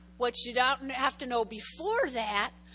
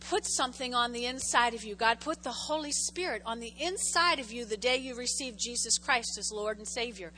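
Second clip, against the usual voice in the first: normal talking voice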